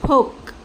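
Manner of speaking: फोक with long o and final stopped k; clear, isolated फोक for learners; sounded-out word. Shown as a demonstration. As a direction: present फोक as the finished blended word. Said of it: "So this is".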